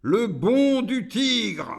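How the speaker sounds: high, slow, drawn-out demand; demanding demon. A narrator's reading. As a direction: stretch it out high as Iblis. Iblis demands again.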